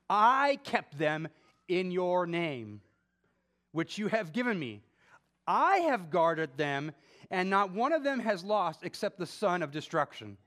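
solemn scripture reading. Acted. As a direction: read the words of Jesus with weight. Reads Jesus' prayer aloud.

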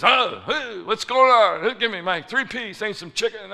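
put-on loud, high impatient old customer; angry yelling. Angry old man yells for his three-piece at the counter, angry yelling. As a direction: yell it like an irate customer demanding food. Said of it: "He's yelling".

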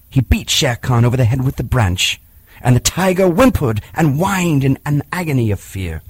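plain reading voice

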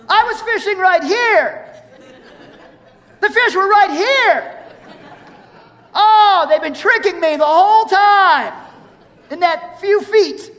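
high, loud, hammed-up outburst; mock exasperated fisherman. Peter reacting to the stranger's fishing advice, mock exasperated. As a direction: play up a fisherman's sarcastic outrage. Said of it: "all about Peter going".

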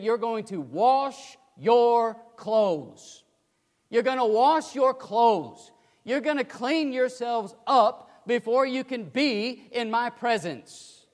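slow, loud emphatic preaching. Acted. Preacher stressing God's command to wash clothes, slow and loud. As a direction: lean hard on each word.

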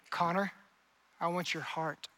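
low, hushed, intimate voice; tender appeal. Acted. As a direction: speak softly and personally, as Jesus to one person. Jesus speaking personally to the preacher.

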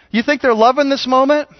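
normal talking voice

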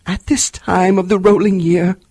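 high, loud lamenting ghost; a tormented spirit crying out; unearthly voice. A narrator's reading. Marley's ghost speaks.

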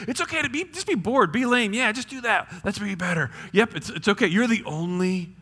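high-pitched mock reassurance; devil's coaxing. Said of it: "the devil will shout".

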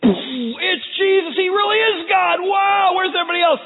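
high, very loud amazed outburst; awestruck shouting. Acted. Disciples seeing the transfiguration, awestruck high shouting. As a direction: shout it out, astonished.

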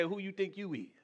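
mocking a rude retort. Preacher imitating how folks talk back, quietly sneering. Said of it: "That's how they say".

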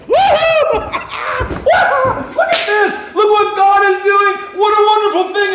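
very high, loud joyful shouting with laughter; excited kids cheering. Pretend. Preacher plays the children in the temple, shrieking with joy. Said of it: "You'd be like".

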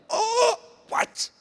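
high, squeaky mock-horrified disbelief; freaking out. Preacher reliving the juniors' talk overheard, freaking out. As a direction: stammer in scandalized shock, building to a yell. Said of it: "I'm, like, freaking out".

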